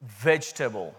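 "Vegetable" in three syllables, VEJ-tuh-bul; careful, low-pitched "Vegetable" with a reduced ending; corrected pronunciation. Shown as a demonstration. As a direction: say "Vegetable" deliberately, swallowing the middle vowel. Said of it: "That's my Indian accent coming out".

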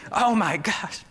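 loud, high gasp of shock; mock startled scream. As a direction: imitate a mom jolted awake in terror. Mom startled awake, retold.

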